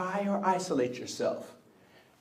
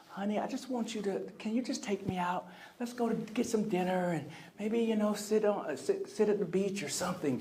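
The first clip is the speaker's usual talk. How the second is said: moping; 4 semitones higher, 6 dB quieter than usual. Retold or imitated. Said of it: "she was moping around the house".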